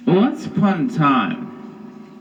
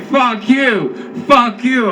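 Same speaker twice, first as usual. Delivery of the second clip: much higher, louder shouting back; shouted defiance; 10 semitones higher, 4 dB louder than usual. Retold or imitated. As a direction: yell it over your shoulder while fleeing. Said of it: "I'm like".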